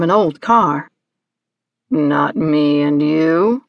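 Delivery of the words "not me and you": low, slow creature voice; deep, haughty questioning; Hagski. Acted. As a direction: draw it out low and imperious.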